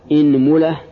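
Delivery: "inmula", opening on i with u after the m; kasra, then damma. Shown as a demonstration. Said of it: "كسر الهمزة". (إنملة بكسر الهمزة وضم الميم)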